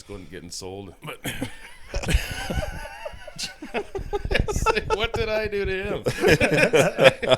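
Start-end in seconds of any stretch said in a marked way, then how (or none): 1.2-4.2 high howling laugh; shrieking laugh. Real, not acted. Big laughing fit. 4.3-7.4 high, slow, laughing cow voice. Pretend.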